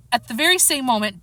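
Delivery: plain reading voice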